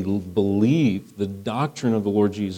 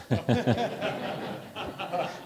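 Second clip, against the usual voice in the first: higher, soft run of chuckles; chuckling laugh; 9 semitones higher, 8 dB quieter than usual. Real, not acted. Preacher joking about his ordination inquisition, soft amused laughter. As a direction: laugh quietly at your own joke.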